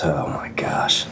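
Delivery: breathless whisper from the heat; whispered gasp. Real, not acted. First taste of the hot sauce.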